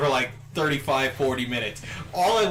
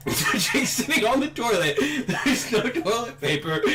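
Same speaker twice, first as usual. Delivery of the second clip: laughing; 8 semitones higher than usual. Real, not acted. Recapping the friend stuck without toilet paper, laughing. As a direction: struggle through it, laughing.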